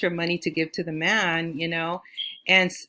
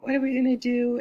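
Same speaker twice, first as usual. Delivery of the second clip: pitched-up panicky question; panicked; 7 semitones higher than usual. Retold or imitated. Stranded in Arizona, asking sister in high panic. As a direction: ask it high, in a retold panic. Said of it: "I panicked".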